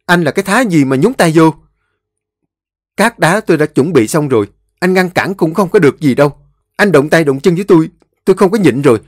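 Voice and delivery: high, loud sustained tirade; furious shouting. A narrator's reading. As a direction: shout him down, no backing off. Brothers quarrel over the house. (giọng hét giận dữ)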